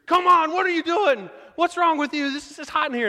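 high, loud outburst of protest; exasperated yell. Retold or imitated. Roughneck in the overheated car erupting at his prankster, exasperated yell.

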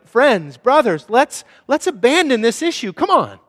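rally them like a leader; rallying call. Acted. Nehemiah urging his brothers, loud and rallying.

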